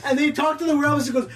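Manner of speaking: ordinary delivery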